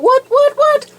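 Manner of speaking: high squeaky what, what; shocked disbelief. Retold or imitated. Shocked at bolted plant.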